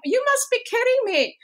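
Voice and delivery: high, playful disbelief; mock disbelief. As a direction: pitch it up as if the idea is absurd. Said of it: "And I'm going".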